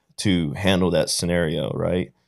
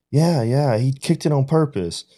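higher voice of a complaining kid, lightly imitated; imitated upset child; 6 semitones higher than usual. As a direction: mimic your upset son tattling. Voicing his upset young son.

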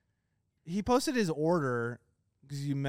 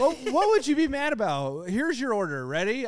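hyped up; 7 semitones higher, 6 dB louder than usual. Real, not acted. Hyping up reading the order.

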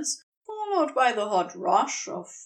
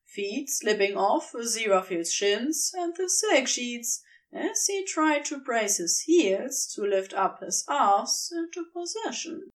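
ordinary delivery

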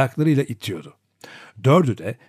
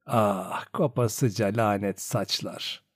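low, soft, slow cursing; hushed grumbling; 3 semitones lower, 7 dB quieter, slower than usual. A narrator's reading. Grandmother combing, trying not to wake the child, hushed grumble. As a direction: grumble under your breath. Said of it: "kısık bir sesle söylenirdi".

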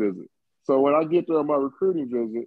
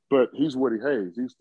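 ordinary talk

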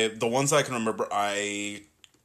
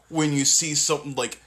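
normal talking voice

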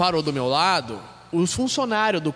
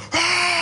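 crowd cheer; 11 semitones higher, 3 dB louder than usual. Retold or imitated.

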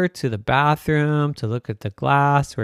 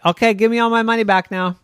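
bossy demand; 7 semitones higher, 4 dB louder, faster than usual. Acted. Littlest child demands.

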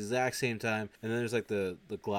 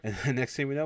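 usual speaking voice

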